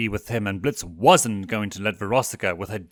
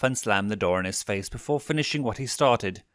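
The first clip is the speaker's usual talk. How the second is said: plain reading voice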